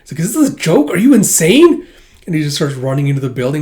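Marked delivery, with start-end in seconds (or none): 0.2-1.9 higher, louder incredulous yelp; outraged, can't believe it; shocked disbelief. Acted. Thug sees a gun drawn and yells in disbelief.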